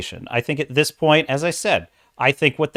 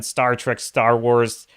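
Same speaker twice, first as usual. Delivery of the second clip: normal talking voice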